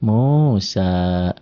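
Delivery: Musa with both vowels drawn out; slow model reading of Musa, held long. Shown as a demonstration. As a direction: stretch the final a of Musa. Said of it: "di sini jadi panjang".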